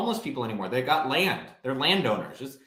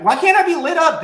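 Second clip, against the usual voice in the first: much higher, loud protest; indignant outburst; 12 semitones higher, 9 dB louder than usual. Real, not acted. Defending himself to the crew, loud and indignant. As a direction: shoot back in protest. Objecting to being called lit up.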